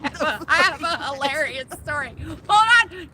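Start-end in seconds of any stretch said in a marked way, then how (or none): 2.5-3.1 loud, high interjection; calling a halt. Real, not acted. Storyteller telling her listeners to wait, calling out loudly. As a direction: cut in loudly to stop everyone.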